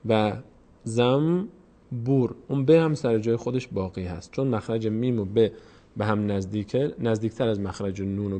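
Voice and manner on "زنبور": zam... bur, زنبور split slowly in two; slow syllable-by-syllable زنبور with m for n; n turned to m. Shown as a demonstration.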